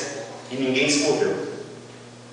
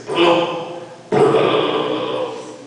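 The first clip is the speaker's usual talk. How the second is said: imitated rushing noise; 3 semitones higher, much slower than usual. Preacher imitating the church coming forward, imitated rushing noise. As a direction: mimic a whole crowd surging with blum sounds. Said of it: "E a igreja fez assim".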